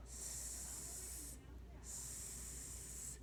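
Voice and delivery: long hissing sss sound; drawn-out s like a snake. Shown as a demonstration. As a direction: hiss it out like a snake. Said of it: "I sound like a snake".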